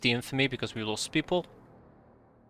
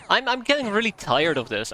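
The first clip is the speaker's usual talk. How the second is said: "getting" hit, higher and louder, exasperated; fed-up outburst; 7 semitones higher, 5 dB louder than usual. Real, not acted. To viewers after losing Chuck, exasperated outburst. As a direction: blurt it out, fed up.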